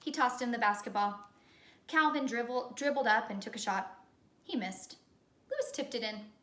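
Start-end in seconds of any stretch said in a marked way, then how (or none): none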